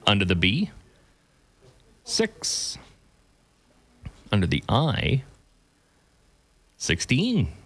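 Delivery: measured calls with long pauses; bingo caller. Real, not acted. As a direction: call each number out slowly and clearly.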